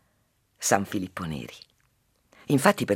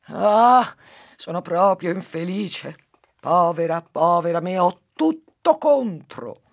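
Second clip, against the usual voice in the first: high, loud theatrical wail of self-pity; wailing housewife; 9 semitones higher, 8 dB louder, slower than usual. Acted. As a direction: wail like a put-upon housewife who just fell. Fallen housewife wails in fable. (lamento acuto e forte della massaia)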